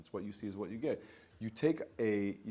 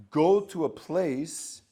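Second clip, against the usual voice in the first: put-on old teaching; 3 semitones higher, 7 dB louder than usual. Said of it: "the world before Baal Shem Tov said".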